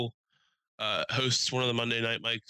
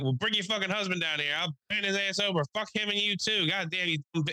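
much higher, hammed-up ranting; mock screaming trash talk; 8 semitones higher than usual. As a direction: tear into her in a mock yell. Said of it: "he's scre-, like, talking shit to her".

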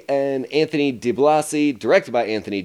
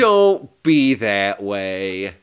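very slow, lower-pitched coaxing; mock pleading; 4 semitones lower, much slower than usual. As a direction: drag out every word, cajoling. Repeated mock pleading, stretched out.

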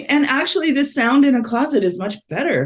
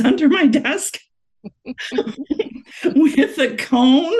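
laughing; 8 semitones higher, slower than usual. Real, not acted. Host laughing at grieving dog.

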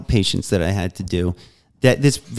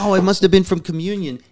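mocking defensive excuse; 8 semitones higher than usual. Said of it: "He's like".

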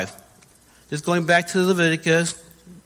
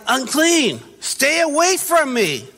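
higher, drawn-out call to keep away; acted warning cry; 6 semitones higher, slower than usual. Outcast leper warning everybody off, calling out. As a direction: call out a warning, slow at the end. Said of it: "You have to tell everybody".

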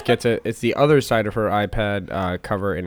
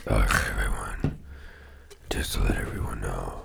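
Batman voice; 10 dB quieter than usual. Pretend. Batman impression after seeing the film.

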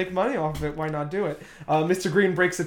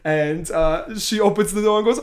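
usual speaking voice

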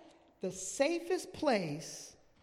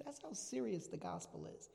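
ordinary talk

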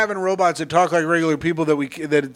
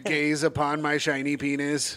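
robot voice; 3 semitones lower, 7 dB quieter than usual. Pretend. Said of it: "go dressed up as a robot".

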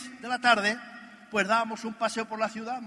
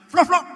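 loud, high imitation of a car unlocking; imitated car beep; 8 semitones higher, 13 dB louder than usual. Showing off the Mercedes remote to Miguel, loud mock car beep.

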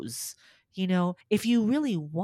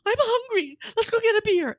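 high, loud put-on husband voice; imitating husband suggesting a beer; husband's voice; 7 dB louder than usual. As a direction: play the cheerful husband hamming it up. Husband comforting her after loss.

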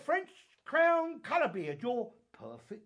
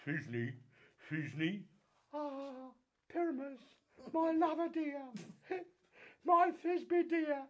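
monstrous little voice; 5 semitones higher than usual. Acted. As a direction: ham a tiny lady voice. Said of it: "I'll speak in a monstrous little voice".

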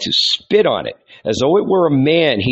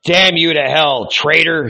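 bellowed; 5 semitones higher, 3 dB louder than usual. A narrator's reading. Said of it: "he bellowed".

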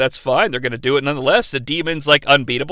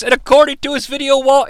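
ordinary delivery

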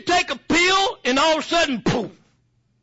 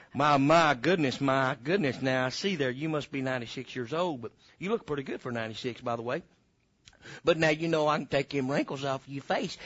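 much lower, softer salesman pitch; infomercial; 9 semitones lower, 5 dB quieter than usual. Acted. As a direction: croon it like a TV pitchman.

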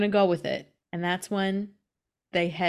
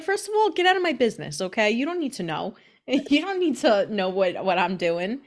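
put-on sassy old lady; 2 semitones higher than usual. Gracie May tells the boys to mind their business, sassy. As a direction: snap back like a nosy old lady.

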